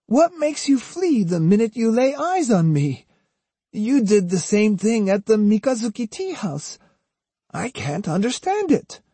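put-on high-pitched, falsely sincere old woman; earnestly poignant pleading. A narrator's reading. Osugi coaxes the terrified Otsu, feigning earnest hurt. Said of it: "in an earnestly poignant tone".

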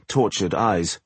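plain reading voice

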